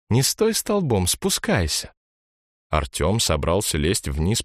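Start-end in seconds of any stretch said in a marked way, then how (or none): 0.1-1.9 put-on girl's voice. A narrator's reading. Girl urges him down.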